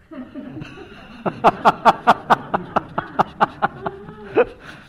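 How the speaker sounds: long high laugh, loud; high delighted laugh. Real, not acted. Recalling his friend's interruption, high delighted laugh. Laughing after Hogan quote.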